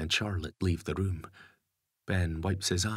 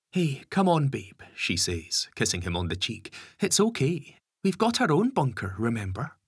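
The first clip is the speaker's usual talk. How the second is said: higher, tender mother; comforting mum voice; 7 semitones higher, 4 dB louder than usual. A narrator's reading. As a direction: soothe the child. Lisa comforts crying Ben.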